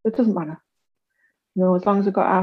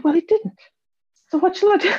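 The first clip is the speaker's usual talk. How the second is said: cheeky; 11 semitones higher, 4 dB louder than usual. Retold or imitated. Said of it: "And I went".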